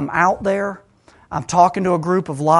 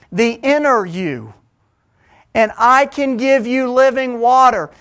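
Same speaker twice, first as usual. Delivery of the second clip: loud, high, driving sermon voice; full-force preaching; 6 semitones higher, 7 dB louder than usual. Acted. Preacher stirring his congregation, full-force preaching. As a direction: preach it out to the whole room.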